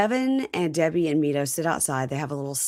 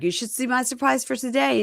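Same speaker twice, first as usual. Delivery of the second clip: higher-pitched, lightly teasing voice; joking tease; 7 semitones higher than usual. Retold or imitated. Groom joking to his bride, playful tease. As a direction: tease her as a joke. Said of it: "then he's joking".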